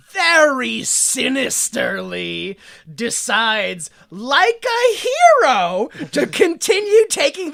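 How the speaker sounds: very slow, drawn-out dramatic emphasis; dramatically sinister. Shown as a demonstration. Narrator recapping Hess's fateful decision, with sinister melodrama. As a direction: stretch each word, climbing high and loud. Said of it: "I say it like that".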